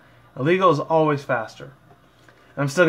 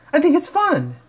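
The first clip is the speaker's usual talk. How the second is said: much higher, louder bubbly pony voice; Pinkie Pie voice; 6 dB louder than usual. Acted. Fan voicing Pinkie Pie cheering friends up, squeaky and high. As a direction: chirp it in a squeaky cartoon voice. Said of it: "Pinkie Pie said".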